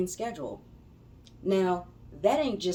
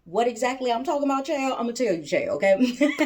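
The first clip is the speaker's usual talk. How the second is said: sassy teasing; 6 semitones higher, 4 dB louder than usual. Real, not acted. Sassy aside to viewers.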